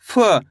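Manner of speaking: फ said short, without the aa vowel; फ as a bare consonant with a brief uh; half sound. Shown as a demonstration. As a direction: give फ only half its sound. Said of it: "फा को आधा पढ़ेंगे".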